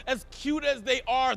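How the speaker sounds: usual speaking voice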